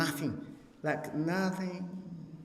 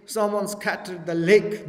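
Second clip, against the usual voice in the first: usual speaking voice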